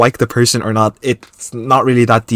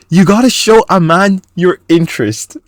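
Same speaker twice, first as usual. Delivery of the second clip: higher-pitched mocking imitation; mocking requote; 7 semitones higher than usual. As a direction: parrot him in a raised, scornful voice. Mockingly requotes the man's message.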